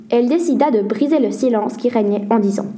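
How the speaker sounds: plain reading voice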